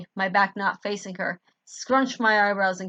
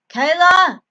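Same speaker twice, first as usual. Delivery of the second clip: high, loud desperate shout; panicked yell of her name; frantic call; 10 semitones higher, 13 dB louder than usual. Acted. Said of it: "I frantically called out".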